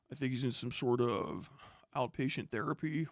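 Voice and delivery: a dismissive imitation of the statement; mocking quote. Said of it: "No, it was".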